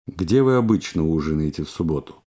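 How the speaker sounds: ordinary talk, nothing marked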